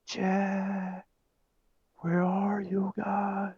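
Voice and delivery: high, slow call from a distance; yelling from far off. Retold or imitated. Drunk friend calling from the cornfield, distant yell. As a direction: call out across a field. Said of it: "you could tell he was yelling".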